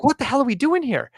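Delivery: high, incredulous outburst; exasperated. Retold or imitated. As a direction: throw up your hands in disbelief. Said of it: "I'm like".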